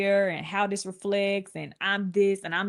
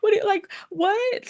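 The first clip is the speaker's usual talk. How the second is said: high-pitched mock wailing; hammed-up crying, pitched way up; boohoo crying; 13 semitones higher than usual. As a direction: blubber it out, imitating your own tearful disbelief. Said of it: "Back then, I was boohoo crying".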